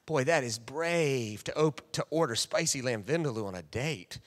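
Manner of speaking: low, soft musing; impressed inner voice. Retold or imitated. Pastor's soft inner voice admiring his date. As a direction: marvel under your breath. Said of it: "And I'm like".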